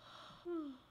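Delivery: high, soft falling exhale; weary sigh. Real, not acted. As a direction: blow out a tired sigh to stay awake. Fighting sleep while working.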